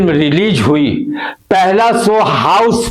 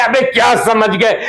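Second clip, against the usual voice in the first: ordinary talk, nothing marked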